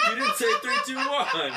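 high, loud laughing complaint; laughing protest. Real, not acted. Protesting the cheat.

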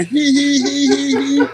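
imitating Renton's giggle in the corner; mock giggle.

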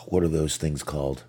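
ordinary delivery

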